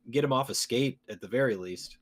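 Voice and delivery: ordinary talk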